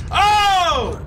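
sudden high, loud oh; jolted by a jump scare; startled shout. Real, not acted. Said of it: "jump scared me".

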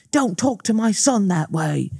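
a hint of a pompous father mocked; mock dad. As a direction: parody the dad, slowed down and high. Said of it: "the dad be like".

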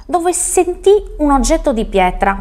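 plain reading voice

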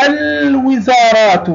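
الوزارات slowly, with a held, clear l; high, drawn-out demo of الوزارات sounding its l; moon lam. Shown as a demonstration. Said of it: "لام قمرية". (نطق «الوزارات» ببطء مع مد اللام الساكنة)

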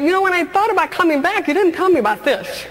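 high, indignant complaint to God; reproach. Retold or imitated. Reproaching God for hiding this before she came back, indignant. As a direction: tell God he left this out. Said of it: "And I said".

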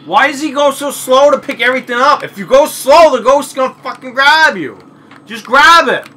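loud, high frustrated shouting; yelling. Real, not acted. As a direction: yell at the screen. Yelling at slow game character.

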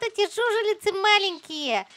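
ordinary talk, nothing marked

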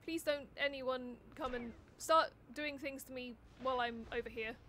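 ordinary talk, nothing marked

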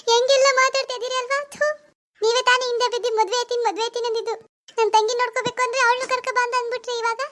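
high-pitched, wounded protest; hurt wife's high wail; tearful reproach. Acted. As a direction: throw his promises back at him, close to tears. Hurt wife answers scolding husband.

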